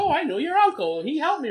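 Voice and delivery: high mock old-lady voice; imitating old lady. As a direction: squeak it out like a sweet old lady in the elevator. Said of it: "there's an old lady who's like".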